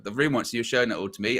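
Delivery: usual speaking voice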